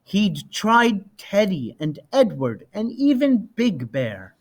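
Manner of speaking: usual speaking voice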